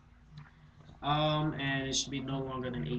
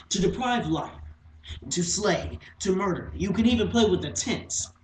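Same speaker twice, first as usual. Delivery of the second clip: agitated recital, building in pitch and force; theatrical, emphatic, growing more worked up; dramatic monologue; 4 semitones higher, 9 dB louder than usual. Acted. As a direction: work through each wording with mounting insistence.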